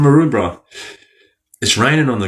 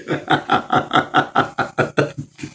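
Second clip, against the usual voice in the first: soft airy trailing laughter; breathy laugh; 4 semitones higher, 5 dB quieter than usual. Real, not acted. Still laughing softly at the joke with Beck. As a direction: let the laugh fade out.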